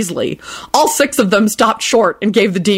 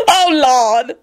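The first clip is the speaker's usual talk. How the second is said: blubbering; 6 semitones higher than usual. Acted. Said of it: "Lucretia blubbered".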